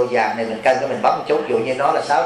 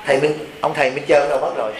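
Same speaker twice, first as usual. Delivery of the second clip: usual speaking voice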